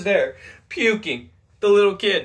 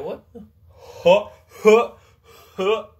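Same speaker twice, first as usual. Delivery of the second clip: loud, rising panting gasps in shock; panicked gasps; 4 semitones higher, 6 dB louder, slower than usual. Retold or imitated. Nurse seeing blood, panicked loud gasping. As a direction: gasp for air, freaking out.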